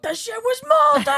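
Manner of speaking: loud, high yell; explosive high shout; acted outraged shout. Other host shouts film line.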